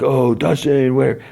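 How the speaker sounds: high-pitched imitation of excited kids; mock excited kids. Kids run up to Dustin.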